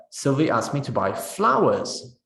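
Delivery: flowers gets the peak with a fall; stress on flowers. Shown as a demonstration. Teacher answering what to buy, stressing flowers. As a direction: save the weight for flowers. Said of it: "What did Sylvie ask you to buy?".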